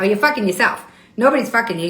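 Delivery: ordinary talk, nothing marked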